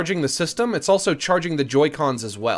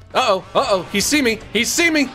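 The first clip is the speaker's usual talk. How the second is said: panicked; 8 semitones higher, 4 dB louder than usual. Real, not acted. Panicking at snake's gaze.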